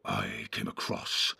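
very low, slow orc whisper; hushed, evil creature voice. A narrator's reading. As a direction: drawl it low and sinister. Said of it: "said the evil voice".

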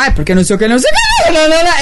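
mock nervous husband going high; husband's nervous voice. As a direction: imitate the husband squeaking up in a panic. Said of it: "ele tá falando assim".

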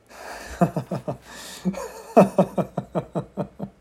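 bursts out in a real breathy laugh at his own reading; real laughter breaking through the read. Real, not acted. Breaking up laughing at the scene, real laughter.

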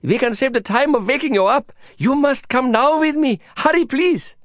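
high, pleading Indian friend voice; Prabhakar's high excited plea; urgent Prabhakar. Acted.

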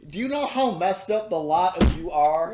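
scolding monkey. Acted. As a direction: scold the whole party. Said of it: "goes, in common".

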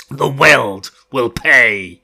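loud, slow, crazed vow; mad threat. Acted. Fane threatens the world, loud crazed vow. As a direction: promise ruin like a madman. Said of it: "He crackled at the night, mad with laughter".

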